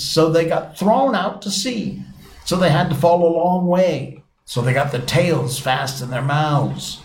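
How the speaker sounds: lightly voiced Griffin laying out the reason; explaining Griffin. Griffin explains whiting tails to Alice, lightly acted explainer.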